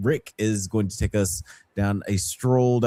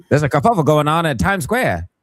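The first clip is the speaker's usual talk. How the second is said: put-on fast, louder old-timey newsman; rapid old-time reporter; 7 semitones higher, 5 dB louder, faster than usual. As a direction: rattle it off like a newsreel. Old-timey radio voice bit.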